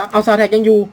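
Soul Attack yelled high and loud; panicked game shout; loud alarmed call. Real, not acted. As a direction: shout it as the monster returns.

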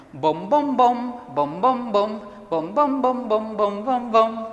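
rhythmic sung plucks on "bom"; double bass imitation. Shown as a demonstration. Teacher shows pupils the bass part, imitating double bass. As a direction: bounce each note like a plucked bass string. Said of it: "el segundo instrumento va a ser el contrabajo".

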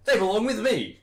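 high, loud possessive yell; retold shout. Reclaiming his scrapers from the front, shouting. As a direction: shout to claim them back.

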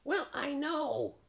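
very high, drawn-out crow. A narrator's reading. Crow conceding the point, slow high crow voice. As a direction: stretch it out high like a bird.

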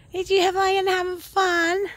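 high, slow cooing to a pet. Real, not acted. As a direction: coo it slowly like to a puppy. Cooing to the tired dogs.

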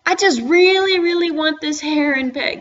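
high, pleading fan gush; gushing longing. Real, not acted. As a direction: gush about something you badly want. Gushing over a pet he wants.

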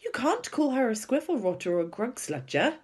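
high-pitched, cross protest; indignant girl's scolding; growing indignation. A narrator's reading. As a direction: object hotly, voice climbing. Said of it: "getting angrier and angrier".

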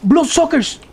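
high, loud alarmed cry; alarmed shout. Real, not acted. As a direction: shout the warning in shock. Spotting monsters in game.

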